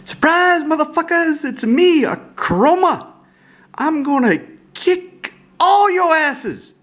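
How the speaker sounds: shrieking, very high, loud taunt drawn out slow; hammed-up angel crowing her big reveal; Akroma taunting. Flipped-up angel card taunting the opponents, a shrieking high battle cry. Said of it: "and she flips over and she says".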